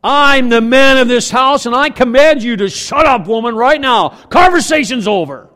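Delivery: loud, commanding mock order; bossy husband. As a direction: bark it like the boss of the house. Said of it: "end an argument by".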